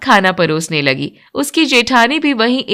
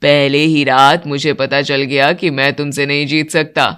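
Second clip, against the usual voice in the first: much lower, steady male voice; older man; 8 semitones lower than usual. Acted. Father and son praising the bride's cooking, much lower voice. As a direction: speak as the elder men of the house. Vijay teases wife.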